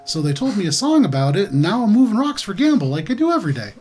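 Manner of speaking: lively sing-song swoops in pitch; childlike in-character voice. Acted. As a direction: innocent character proudly reporting the day. Player voices Glub character.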